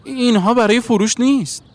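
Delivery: high, loud protest; defensive shout. A narrator's reading. Boy pockets his stones before the stranger, high loud refusal. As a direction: refuse loudly, pitched right up.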